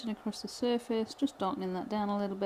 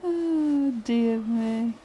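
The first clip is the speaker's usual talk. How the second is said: higher, still laughing; 3 semitones higher, 5 dB louder, much slower than usual. Real, not acted. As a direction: sigh it out amused, louder. Host laughing at Wayne's comment.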